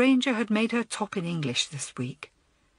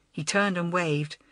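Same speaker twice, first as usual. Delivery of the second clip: ordinary delivery, nothing marked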